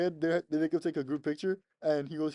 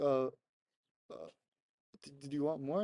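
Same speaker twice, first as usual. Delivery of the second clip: halting, hesitant question with pauses; mildly acted puzzled stammer; confused; 4 dB quieter than usual. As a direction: stumble uncertainly into the question, imitating confusion. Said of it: "kinda, like, confused".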